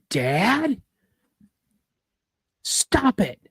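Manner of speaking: high-pitched retold complaint; annoyed kid protest. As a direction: call out to dad like an exasperated kid. Child calls down, annoyed at dad.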